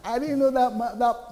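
high, loud mock gasp of disbelief; shocked disbelief. Congregant hearing bad news, mock shocked disbelief. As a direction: act out shocked surprise.

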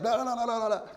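high, sharp string of no's; exasperated high no, no, no; annoyed refusal. Retold or imitated. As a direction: refuse in a rising fit of annoyance. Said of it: "I was really annoyed".

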